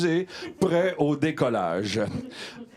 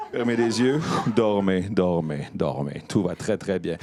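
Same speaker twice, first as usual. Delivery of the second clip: hypnotic induction; 8 semitones lower than usual. Acted. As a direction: draw each dormez out, sinking.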